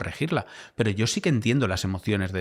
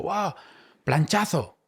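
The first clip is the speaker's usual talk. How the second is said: colleague's excited exclamation; 8 semitones higher, much slower than usual. Retold or imitated. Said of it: "Y el compañero me dijo".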